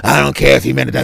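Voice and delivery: put-on stubborn drunk snapping back; drunk retort. Drunk Bill waves off his friends, gruff mock retort.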